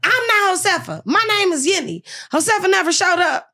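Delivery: high mock voice of the indignant nurse; imitating the nurse. Nurse correcting the patient's mistake, high mock voice. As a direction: correct her in a high put-on voice. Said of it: "She was like".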